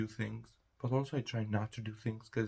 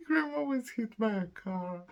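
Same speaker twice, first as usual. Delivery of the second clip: high, laughing through the words; can't hold back laughter; squealing giggle. Real, not acted. Laughing confession.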